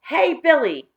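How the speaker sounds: sudden outburst. Acted. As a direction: blurt it out to stop him. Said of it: "burst out Tom suddenly".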